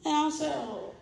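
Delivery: calm a riled-up newcomer down softly; gentle sponsor. Retold or imitated. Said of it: "that's just how she was talking to me".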